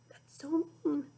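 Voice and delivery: soft unvoiced whisper; hushed disapproval; whispered aside. Real, not acted. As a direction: whisper it to the class. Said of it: "teacher's whispered aside".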